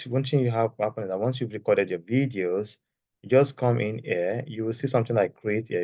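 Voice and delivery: ordinary delivery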